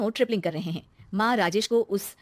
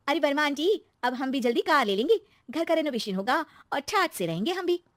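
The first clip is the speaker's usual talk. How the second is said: put-on lightly higher, chirpy young wife; excited daughter-in-law; 6 semitones higher than usual. Daughter-in-law telling neighbour aunty her plans, excited. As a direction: share good news proudly.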